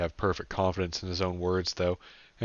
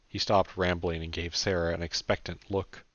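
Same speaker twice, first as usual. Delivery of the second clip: usual speaking voice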